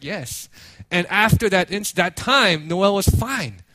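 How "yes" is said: soft, low little yes; retold small reply. Consoled daughter answering her father, soft and small. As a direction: imitate a child quietly agreeing. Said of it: "Noah's like".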